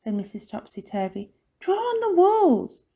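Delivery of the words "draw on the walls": high, loud instruction; emphatic parental order. A narrator's reading. Missus Topsy-Turvy telling Lucy where to draw, high and emphatic.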